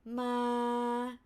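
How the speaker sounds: "Ma" level in mid pitch; flat tone. Shown as a demonstration. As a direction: hold "Ma" straight at normal pitch. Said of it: "flat tone".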